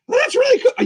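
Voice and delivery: very high, squeaky approval; high puppet voice. Acted. Boogeyman puppet reacting to the guest, very high squeaky voice. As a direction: switch up into creature falsetto.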